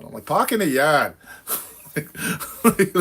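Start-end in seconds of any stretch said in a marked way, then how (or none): 0.2-1.1 mock New England accent, pahk in the yahd; Boston accent. Replying to his wife in a mock Boston accent. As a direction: drop the r's New England style. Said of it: "I was talking like that one day".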